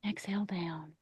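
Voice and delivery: whispered on an outgoing breath; hushed exhale cue. Real, not acted. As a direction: drop to a soft whisper, guiding the breath out. Leading a slow exhale.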